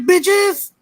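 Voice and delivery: high, excited hype cry; triumphant yell. Acted. Hyping the show's return to his co-host, excited high cheer. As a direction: shout it like a victory cheer.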